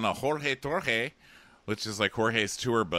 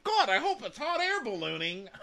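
high-pitched mock hopefulness; Venmo; 14 semitones higher than usual. Venmo hopes for ballooning date, high mock excitement. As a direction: put on a high, silly eager voice. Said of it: "and Venmo's like".

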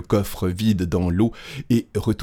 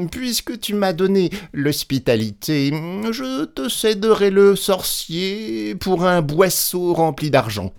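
conceding Little Claus; 11 semitones higher than usual. A narrator's reading. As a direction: give in as if doing a favour. Little Claus grudgingly gives in. (voix haute, faussement réticente)

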